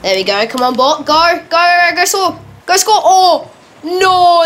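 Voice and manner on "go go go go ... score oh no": high, loud urging turning into a wail; urgent cheering to dismay. Real, not acted. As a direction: cheer the bot on, then cry out. Urges bot on, then misses.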